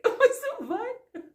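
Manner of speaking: high, slow groan through laughter; laughing dismay. Retold or imitated. Woman fallen in sewage, laughing at herself. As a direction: laugh at your own mess.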